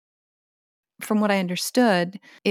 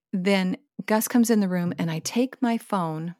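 ordinary delivery

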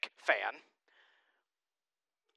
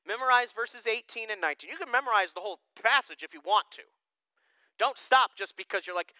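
ordinary talk

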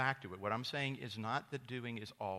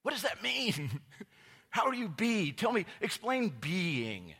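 high, loud sarcastic squeak; mock exasperated self; 11 semitones higher, 8 dB louder than usual. Pastor retelling his reply to a trendy friend, high sarcastic voice. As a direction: play your younger self, baffled and mocking. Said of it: "And I was like".

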